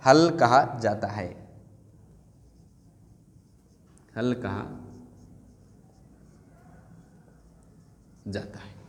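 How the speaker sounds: dictating हल slowly and fading out; slow dictation. Shown as a demonstration. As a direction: let the answer trail off as they write. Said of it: "और व्यंजनों को क्या कहा जाता है?".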